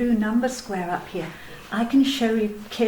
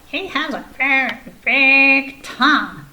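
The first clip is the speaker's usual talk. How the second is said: Snail puppet voice; 2 semitones higher, 6 dB louder than usual. Acted. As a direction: play a chirpy snail puppet showing off.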